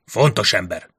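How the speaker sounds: ordinary delivery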